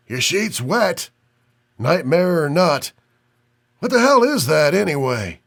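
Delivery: put-on high, coughing old man with Chicago accent; sick old man, Chicago accent. A narrator's reading. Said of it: "a cough talking with a heavy Chicago accent".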